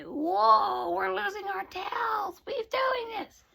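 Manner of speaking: very high, drawn-out cartoon wail; alarmed little lizards. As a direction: squeal slowly in comic amazement. Lizards exclaim as they transform.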